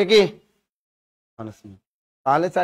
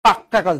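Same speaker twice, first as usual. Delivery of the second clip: পাক্কা punched with a held k; firm double-k পাক্কা; emphatic; 4 dB louder than usual. Acted. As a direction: lean hard on the kk of পাক্কা. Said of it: "যদি জোর দিয়ে কয়".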